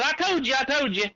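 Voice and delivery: high, gloating singsong; teasing I told you. Retold or imitated. Hunting buddy teasing the scared hunter, high gloating tone. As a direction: rub it in like a friend. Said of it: "Started laughing".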